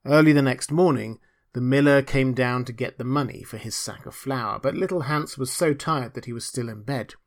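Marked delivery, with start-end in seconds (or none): none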